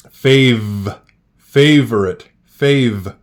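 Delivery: "Fave" loud with a held V; sounding out words. Shown as a demonstration. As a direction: hit the V in "Fave". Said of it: "I hear a V".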